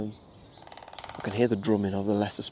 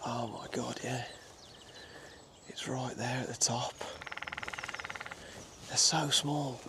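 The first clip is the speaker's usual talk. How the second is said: softly amazed under the breath; quietly thrilled, trying not to spook it; hushed awe; 2 semitones higher, 12 dB quieter, slower than usual. Real, not acted. Spotting the tiny woodpecker atop the oak, hushed awe.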